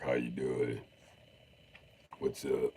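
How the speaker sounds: low, soft, burped-out American; British person badly faking American, low; burpy fake American accent. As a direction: talk American as if burping. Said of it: "they just talk like they're burping".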